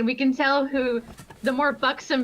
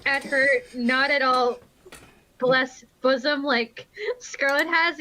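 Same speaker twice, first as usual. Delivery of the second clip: normal talking voice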